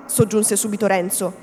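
ordinary delivery

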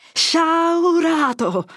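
put-on an old friar's voice regaining its full power in a shout; thundering shout. A narrator's reading. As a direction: roar the word out, slowly and fully. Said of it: "voce che aveva ripigliata tutta l'antica pienezza e sonorità".

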